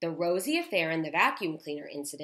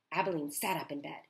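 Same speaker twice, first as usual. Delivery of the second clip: ordinary delivery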